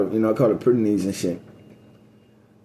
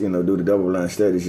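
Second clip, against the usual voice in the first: usual speaking voice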